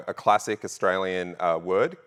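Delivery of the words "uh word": ordinary talk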